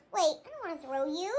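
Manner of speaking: low, hushed second thought; relenting. Pretend. Puppet stops short of throwing the friend, relenting. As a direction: drop suddenly low and soft.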